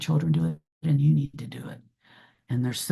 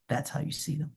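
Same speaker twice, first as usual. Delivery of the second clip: much softer repeat of "That's how you see them", almost breathed; soft echo; 9 dB quieter than usual. Real, not acted. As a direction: let the line fade out gently.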